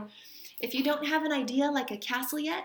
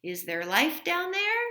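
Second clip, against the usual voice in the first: playful call down the stairs, pitched higher; a mother calling down to the basement, lightly raised; 6 semitones higher, 5 dB louder than usual. Acted. Mother calling down the basement stairs, teasing call. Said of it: "would call out".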